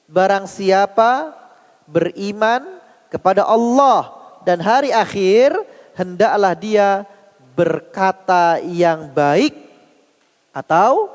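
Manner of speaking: very slow, deliberate phrase-by-phrase delivery; solemn hadith translation. Acted. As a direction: draw out each phrase, pausing between them.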